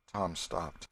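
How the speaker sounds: usual speaking voice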